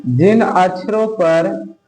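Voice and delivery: word by word, long pauses between chunks; slow dictation. Acted. Teacher dictating the definition of बलाघात, slow and chunked. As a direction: dictate slowly for note-taking.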